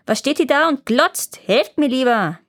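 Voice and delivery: the trapped dwarf glares at the sisters, angry and high. Acted. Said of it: "Wütend funkelte er die Schwestern an".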